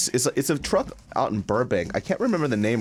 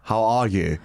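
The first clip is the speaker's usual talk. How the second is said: mock Australian greeting; Australian accent; 2 semitones lower, slower than usual. Greeting the co-host on the show, Australian accent. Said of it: "Oh, you're doing the Australian".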